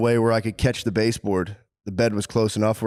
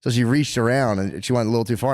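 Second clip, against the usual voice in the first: normal talking voice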